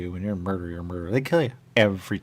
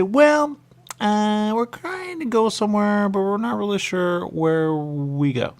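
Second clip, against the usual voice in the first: very high, louder put-on voice, slow at the end; strange high voice; 13 semitones higher, 7 dB louder, slower than usual. Player answers game characters in a very high voice. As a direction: squeak it out like a tiny creature. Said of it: "you start to speak in this way".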